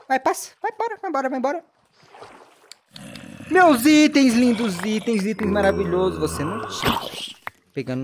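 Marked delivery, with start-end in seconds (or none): 0.1-1.6 high, softer shooing chant. Acted. Player shooing zombies, high soft shooing. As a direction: wave them off with a high sing-song.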